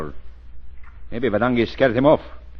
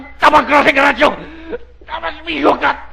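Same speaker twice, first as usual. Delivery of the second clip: high furious yelling; angry shout; 6 dB louder than usual. Acted. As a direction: shout accusations at partner. Gangster accusing partner.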